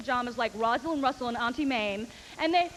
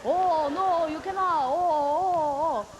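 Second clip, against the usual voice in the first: higher, louder mock-accent imitation; louder mocking Chinese accent; 4 semitones higher, 5 dB louder, much slower than usual. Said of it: "just go along with it".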